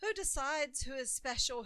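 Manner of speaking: plain reading voice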